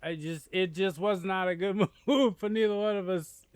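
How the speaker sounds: loud high laughing complaint. Real, not acted. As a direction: talk through laughter. Laughing after death.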